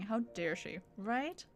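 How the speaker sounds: usual speaking voice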